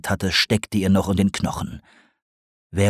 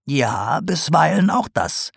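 higher, knowing teasing voice; sly old potter; 7 semitones higher than usual. A narrator's reading. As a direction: tease the owl like a crafty old potter. Sarg continues.

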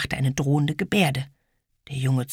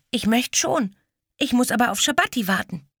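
put-on little girl; 9 semitones higher than usual.

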